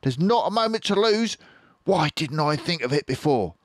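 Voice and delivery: high, loud eureka shout; excited fox shouting. Acted. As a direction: burst out with the idea. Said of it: "He jumped up and shouted".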